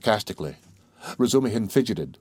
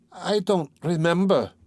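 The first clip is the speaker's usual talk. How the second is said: slow, halting, higher I don't remember; faltering; 9 semitones higher, slower than usual. A narrator's reading. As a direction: let the words stumble out slowly. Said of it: "faltered Pulcheria Alexandrovna".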